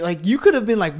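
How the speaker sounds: normal talking voice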